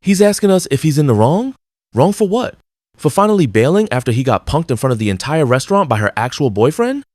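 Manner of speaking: can't believe he's even asking; incredulous. Real, not acted. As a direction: pitch leaps way up in disbelief. Incredulous commentary on the story.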